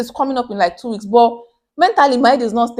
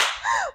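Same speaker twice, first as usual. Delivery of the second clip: sharp gasp before crying; 6 dB quieter than usual. Retold or imitated. Breaking down crying to mom.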